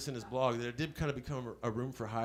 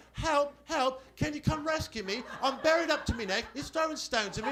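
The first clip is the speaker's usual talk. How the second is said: lightly voiced pleading girl, high; hammed-up cry for help, high; mock damsel; 11 semitones higher, 3 dB louder than usual. Ex-girlfriend phones begging for rescue, high mock plea.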